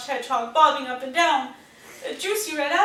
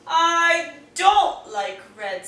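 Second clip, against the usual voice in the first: sulky dislike; 2 semitones higher, 7 dB louder, much slower than usual. A narrator's reading. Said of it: "said yellow".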